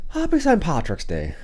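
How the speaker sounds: slow, hammed-up Irish lilt on each word; a playful attempt at an Irish greeting, slow; Irish accent. Vlogger greets viewers on Saint Patrick's Day, slow mock Irish accent. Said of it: "That's my best Irish impression".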